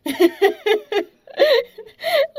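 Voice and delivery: loud, high burst of giggles; long, helpless acted giggle; giggling. A narrator's reading. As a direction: giggle loudly before speaking. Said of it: "ridacchiò la ragazza".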